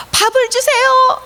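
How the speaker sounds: high, acted begging call; begging Bartimaeus. Guessing Bartimaeus's wish for food, high begging. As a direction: beg in a high, stretched voice. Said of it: "라고 했을까요".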